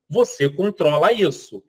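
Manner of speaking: usual speaking voice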